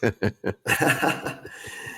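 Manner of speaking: short amused chuckle; laugh. Real, not acted. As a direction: laugh lightly after the joke. Amused after the rap clip plays.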